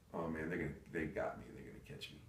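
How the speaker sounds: low, very soft voice to yourself; anxious inner thought. Retold or imitated. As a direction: think it quietly while hiding. Hiding, thinking to himself.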